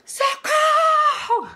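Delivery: imitating a high, drawn-out cry of socorro; mock scream for help. The investigated woman screams for help at the condo, high drawn-out mock scream. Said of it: "a delegada começa a gritar".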